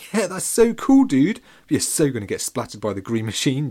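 high, loud mock enthusiasm; gushing like an amused older kid; excited, teasing chuckle. A narrator's reading. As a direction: tease him with big excitement. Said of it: "Jenny chuckled".

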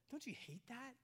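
ordinary delivery

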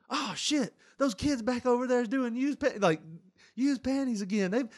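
hammed-up high clerk, caught off guard; store clerk. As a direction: blurt out apologies to the customer. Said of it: "the guy's like".

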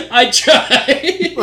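high, loud laughing protest. Real, not acted. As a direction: squeal it through real laughter. Laughing at own bad accent.

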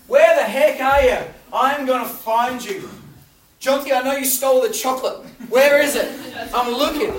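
a mock-furious parent hunting the house for the culprit; angry parent.

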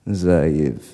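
low father voice, falling; father's voice. Retold or imitated. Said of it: "He's talking on the telephone".

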